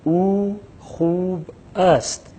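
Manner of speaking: او خوب است slowly, every sound clear; slow Persian model. Shown as a demonstration. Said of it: "ہم تلفظ کر رہے ہیں". (او خوب است کو آہستہ اور صاف)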